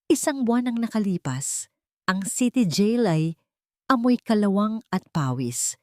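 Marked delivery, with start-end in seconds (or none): none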